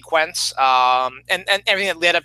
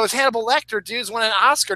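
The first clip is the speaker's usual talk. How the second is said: ordinary delivery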